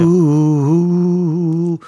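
long crooned oo; drawn-out crooning. Pretend. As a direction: croon the oo slowly. Drawn out howl.

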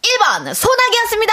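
hyped answer reveal. Acted.

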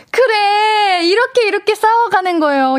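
fake-sob the line for comic effect; mock crying. Laughs at listener's marital spat. (장난스럽게 우는 소리로)

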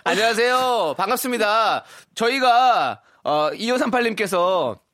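high, loud friendly greeting; excited greeting. Real, not acted. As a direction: greet the caller brightly. Greeting caller. (높고 밝은 인사)

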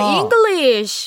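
잉글리쉬 drawn out slowly in a put-on English accent; English pronunciation. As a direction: say 잉글리쉬 slowly, like a native speaker. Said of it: "영어가 잉글리시지". (잉글리쉬를 느리게 원어민처럼 굴려서)

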